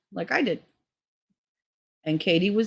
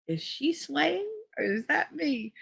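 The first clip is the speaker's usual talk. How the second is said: slow, high, soft puzzlement; stoned wondering; 11 semitones higher, 6 dB quieter, slower than usual. Retold or imitated. High in front of his mother, dazed wondering.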